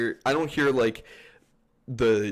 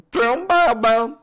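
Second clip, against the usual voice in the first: loud, high mock game callout; cartoon parody voice; 13 semitones higher, 10 dB louder than usual. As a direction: holler it like a goofy animation character. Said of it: "the Left four Sped animations".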